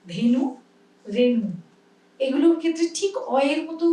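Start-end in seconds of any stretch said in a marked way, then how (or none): 0.1-1.5 ধেনু and রেণু with a clear closed e; এ ধ্বনিতে ধেনু রেণু; e sound. Shown as a demonstration. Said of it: "ওখানে দেখো".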